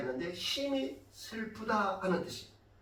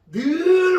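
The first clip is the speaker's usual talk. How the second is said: 늘 stretched long, higher and louder; drawn-out emphasis; 8 semitones higher, 7 dB louder, much slower than usual. Shown as a demonstration. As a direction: hold the vowel of 늘. Stressing that she always came.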